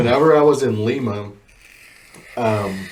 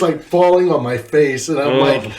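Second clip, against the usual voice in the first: ordinary delivery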